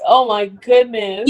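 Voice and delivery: ordinary talk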